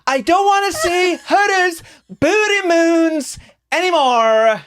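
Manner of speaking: high, drawn-out wail of outrage; mock ranting yell. Co-host imitating partner heard through the wall, mock wailing. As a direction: mimic a loud rant, stretched out and high. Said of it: "in the background, I just hear".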